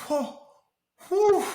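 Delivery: very high whoa, then a loud high whew; awed whoa. Retold or imitated. Overwhelmed by the Lord's words, a high awed whew. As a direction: breathe it out in amazement. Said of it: "And I was like".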